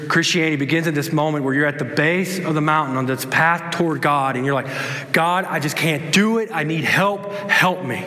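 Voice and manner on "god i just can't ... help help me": higher, pleading cry for help; a mild, imitated desperate prayer; desperate plea. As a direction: beg God for help as if at the end of your rope. Pleading prayer at mountain base.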